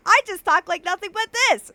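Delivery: high, loud cartoon birdie demo; origami bird voice. Shown as a demonstration. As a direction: show off the silly bird voice. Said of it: "where my origami bird, bird voice comes on".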